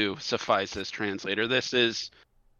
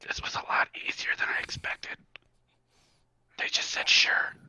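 whispered aside; faster than usual. Acted. Translator confides in the hero after success, whispering. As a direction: confide it secretly to your partner.

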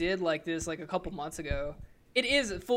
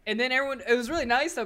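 ordinary delivery

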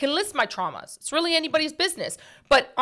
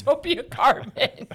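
laughing; 2 semitones higher than usual. Real, not acted. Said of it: "repeats joke laughing".

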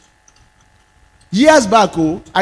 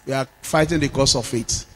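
softer, lower repeat; quieter repeat; 5 semitones lower, 7 dB quieter than usual. Real, not acted. Pastor quietly repeating to the church, softer. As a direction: let it drop into a quiet reflective repeat.

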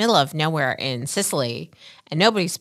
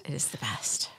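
true whisper, no voice; 4 semitones lower, 14 dB quieter than usual. Pretend. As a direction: breathe it out in a whisper. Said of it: "echo game, whispered".

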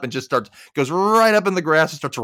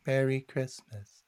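slow, soft, vacant delivery; catatonic; 11 dB quieter, slower than usual. Retold or imitated. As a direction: say it as if staring into space. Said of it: "like, all catatonic".

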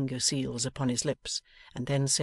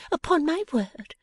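put-on higher playful young woman; 9 semitones higher than usual. A narrator's reading. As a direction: tease him brightly. Bella voiced.

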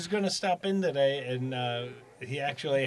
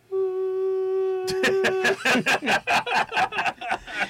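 squeaky high laugh. Retold or imitated. High laugh after imitating Vinny.

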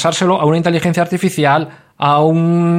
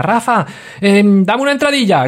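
hammed-up needy listener, higher; mock listener request; 7 semitones higher than usual. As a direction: whine it out like a needy fan.

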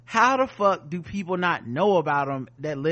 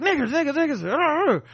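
words barked in a high pitch; 11 semitones higher, 4 dB louder, much faster than usual. Retold or imitated. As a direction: imitate dogs barking the words. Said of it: "so these dogs like".

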